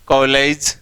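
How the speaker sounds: ordinary delivery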